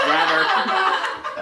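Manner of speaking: usual speaking voice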